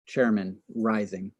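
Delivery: ordinary talk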